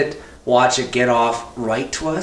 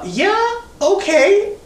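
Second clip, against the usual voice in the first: high nervous squeak; much slower than usual. Real, not acted. Nervous agreement to massage.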